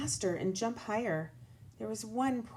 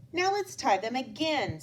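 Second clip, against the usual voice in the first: put-on higher, upbeat girl voice; Kelly urging more practice; encouraging girl; 6 semitones higher, 3 dB louder than usual. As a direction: cheer him into practice. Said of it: "said Kelly".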